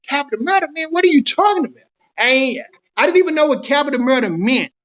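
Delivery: high-pitched, disbelieving retort; retold shock, voice pitched well up; incredulous protest.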